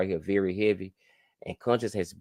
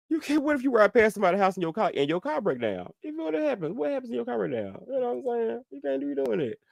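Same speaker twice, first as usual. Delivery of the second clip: high amused squeal; 13 semitones higher than usual. Real, not acted. Laughing at the broken-down car.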